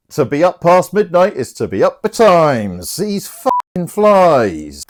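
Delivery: put-on high, loud, slurred drunk knight; drunk Sir Toby. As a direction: ham it up like a sloshed old knight. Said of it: "Sir Toby and Sir Andrew are bollocks".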